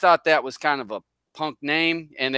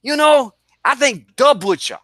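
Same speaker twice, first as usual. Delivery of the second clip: mock Boston accent, much higher and louder; 10 semitones higher, 4 dB louder than usual. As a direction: imitate the Boston guy, pitched way up. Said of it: "that Boston".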